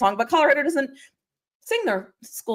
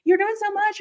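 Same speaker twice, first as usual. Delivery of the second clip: mock fan; 11 semitones higher than usual. Repeated imitated fan line.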